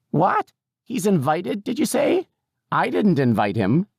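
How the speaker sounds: put-on very high, querulous old lady; disbelieving old woman. A narrator's reading. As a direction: question it in a high, indignant squawk. Old woman disbelieves caregiver's explanation.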